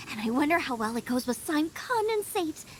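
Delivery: ordinary delivery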